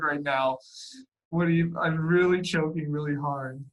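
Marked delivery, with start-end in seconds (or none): none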